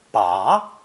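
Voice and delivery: "ba" drawn out, climbing at the end; rising tone. Shown as a demonstration. Teacher running through four tones, rising "ba". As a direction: stretch "ba" and glide it upward. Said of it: "pronounced with a rising tone".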